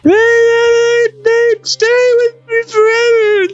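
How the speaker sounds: very high falsetto wail; hammed-up sobbing. A narrator's reading. Selphie sobs to Quistis, hammed-up falsetto crying. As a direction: screech it through fake sobs, dragging the words. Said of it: "sobbed Selphie".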